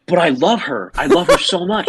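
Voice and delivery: put-on high, overwrought drunken declaration; mock lovesick pleading. Drunk Tony declares love for the date, high mock pleading.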